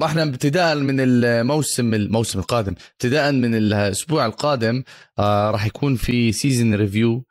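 normal talking voice

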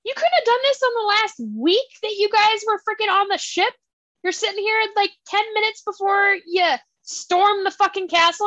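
high-pitched incredulous rant; exasperated scolding. Acted. Scolds the character over his timing.

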